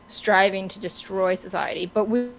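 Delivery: normal talking voice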